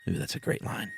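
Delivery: breathy hushed aside, all air; whispered aside. Real, not acted. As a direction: whisper the comment under your breath. Whispered aside during reading.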